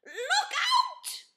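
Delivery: high, loud panicked yell; loud, high alarm cry; bellowing. Acted. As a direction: bellow a warning. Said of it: "bellowed Amos".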